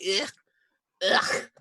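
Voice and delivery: acted gagging noise; mock-revolted throat noise; disgusted retching ugh. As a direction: spit out a foul taste. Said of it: "while I'm like".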